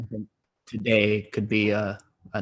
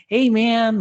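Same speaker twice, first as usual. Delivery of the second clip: high, loose stoner drawl; hippie stoner voice; 7 dB louder than usual. Retold or imitated. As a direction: do the laid-back hippie voice. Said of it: "very hippie, stoner sounding voice".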